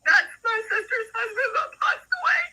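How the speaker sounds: high, sobbing cry with a broken laugh; real tears breaking through the words. Real, not acted. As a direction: bursts into tears as the name lands. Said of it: "I'm crying out about that".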